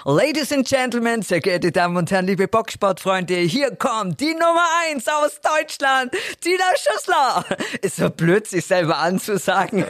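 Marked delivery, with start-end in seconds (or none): 0.0-7.5 boxing ring announcer. Shown as a demonstration. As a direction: call her into the ring, stretching every word. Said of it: "wie würdest du denn einen Kampf von Tina Schüssler ankündigen als Ringsprecherin".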